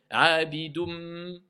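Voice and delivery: "Abidum" slow, closing on a long held m; "Abidum" with the final n merged into a nasal m; assimilated nun. Shown as a demonstration. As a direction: draw out the hum at the end of "Abidum". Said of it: "comment je procède".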